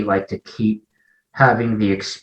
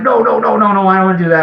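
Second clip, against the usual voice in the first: higher and louder, no no no; backing away in a panic; alarmed refusal; 10 semitones higher, 5 dB louder, much faster than usual. Retold or imitated. Friends invite him to lunch and he refuses, higher and louder. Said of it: "I'm like".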